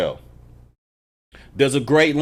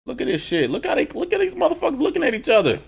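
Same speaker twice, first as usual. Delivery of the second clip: excited; 13 semitones higher, faster than usual. Real, not acted. Hyped reacting to a scene.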